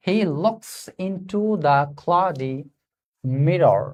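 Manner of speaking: He looks into the cloudy read very slowly, word by word; slow reading aloud. Shown as a demonstration. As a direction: spell out each word slowly for the students. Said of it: "ঠিক আছে?". (খুব ধীরে শব্দ ধরে ধরে পড়া)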